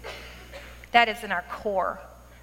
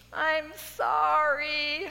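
drawn-out, higher comic sob-talk; mock tearful wail; 5 semitones higher, much slower than usual. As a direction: wail it like a hammed-up crying friend. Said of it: "She's crying".

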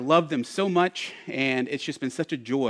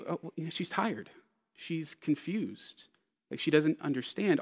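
gentle understanding; 2 semitones higher, 7 dB quieter than usual. Retold or imitated. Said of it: "be able to say".

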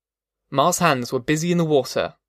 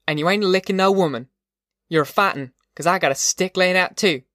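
higher-pitched rural mother scolding her husband; tough mother, defiant; 3 semitones higher than usual. Acted. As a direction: stand up to him, unafraid.

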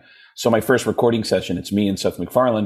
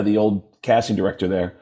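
normal talking voice

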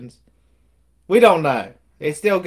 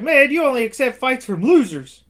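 higher, louder sneering jab; mock taunt; 9 semitones higher, 5 dB louder than usual. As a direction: sneer it at the champ. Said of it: "and he's like".

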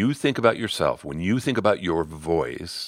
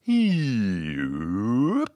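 siren-like vocal slide; tossed-off pitch slide; 2 semitones higher than usual. Shown as a demonstration. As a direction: swoop down and up on one vowel. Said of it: "you start tossing 'em off".

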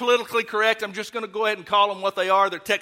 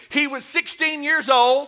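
call for response; 6 semitones higher, slower than usual. Shown as a demonstration. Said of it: "say this with me".

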